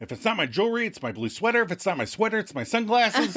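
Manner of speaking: high, fast exasperated rant; scolding tirade. Retold or imitated. Monica refusing Rachel a hug, scolding. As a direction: rattle off a rising list of grievances. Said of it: "Monica immediately changes persona".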